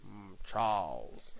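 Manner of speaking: slow, low villain calling Charles; menacing comic-book villain voice; Magneto voice. Pretend. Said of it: "we read 'em in voices".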